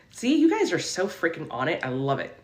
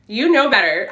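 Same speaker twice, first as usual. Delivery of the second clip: teasing scold; 4 semitones higher, 6 dB louder than usual. Acted.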